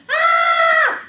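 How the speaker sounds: loud high-pitched mock scream; imitated scream. Storyteller imitating the scream she heard from the gardens, screaming. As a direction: scream like someone being attacked. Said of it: "But then I heard".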